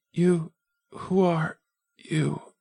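put-on high, slow, gasping girl's voice; a frightened wounded girl fighting for each word; gasping young girl. Wounded girl to the stranger, high and gasping. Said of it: "Her words came in gasps".

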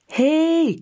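a sharp high call across the room. A narrator's reading. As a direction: call after him before he's out the door. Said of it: "roept ma".

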